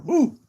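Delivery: sharp high woo from sourness. Real, not acted. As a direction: let out a high sour woo. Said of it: "very sour".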